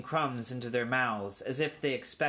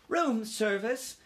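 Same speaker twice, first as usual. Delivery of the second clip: high, slow, incredulous echo; mother mouse aghast; exclaiming mother; 10 semitones higher, slower than usual. A narrator's reading. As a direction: throw it back as a rising question. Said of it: "exclaimed his mother".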